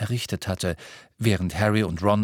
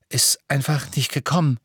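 higher, winded excuse; panting; 5 semitones higher than usual. A narrator's reading. Harry explains his failed Patronus, still panting. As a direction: push it out while short of breath. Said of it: "keuchte er".

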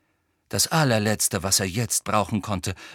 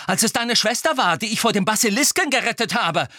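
raised, accusing tone; angry lashing out; 12 semitones higher, 4 dB louder than usual. A narrator's reading. Harry rounding on Ron about his sister, angry. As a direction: turn on him angrily. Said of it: "Nun fuhr er Ron an".

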